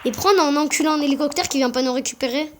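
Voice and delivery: usual speaking voice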